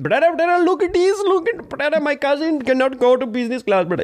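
squeaky imitation of the Thai airline worker; Thai staff voice. Airline worker begging the storyteller, put-on high voice.

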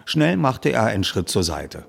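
ordinary delivery

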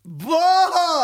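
loud, high drawn-out wow; a big, high cry of astonishment; amazed outburst. Real, not acted. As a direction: burst out in real amazement. Amazed reaction to pilot's seat story.

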